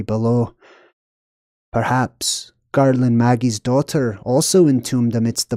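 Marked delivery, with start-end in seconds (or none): none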